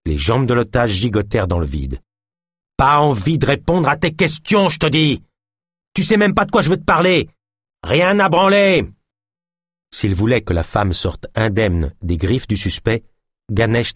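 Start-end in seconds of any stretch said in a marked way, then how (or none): 2.8-8.9 high, loud, out-of-control yelling; furious shout. Acted. Philémon screams at Ganesh that he won't answer, furious shout. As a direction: lose it completely. Suspect screams refusal at cop.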